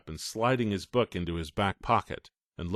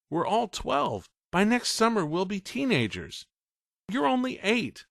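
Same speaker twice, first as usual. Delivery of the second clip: higher, firm big-brother talk; older brother lecturing; 9 semitones higher than usual. Acted. Ray lecturing his little brother, firm. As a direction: set him straight kindly. Ray's voice held.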